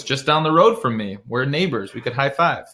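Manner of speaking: ordinary delivery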